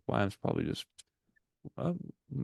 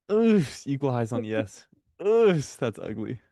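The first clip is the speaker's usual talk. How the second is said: disgusted groan; 4 semitones higher, 5 dB louder than usual. Real, not acted. Frustrated at chart.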